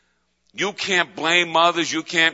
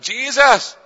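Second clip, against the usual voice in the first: high, raised call; crying out; 7 semitones higher, 4 dB louder than usual. Acted. Believer alone with God calling to Jesus, crying out. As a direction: cry the name out from inside. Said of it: "Where's that cry that comes inside?".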